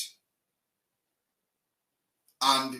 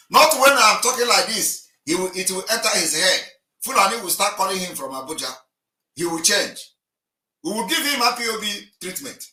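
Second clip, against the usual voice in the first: loud, higher, threatening warning; a real heated threat, louder and higher; 6 semitones higher, 7 dB louder than usual. Real, not acted. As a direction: raise the voice and warn him hard. Heated argument on live call-in.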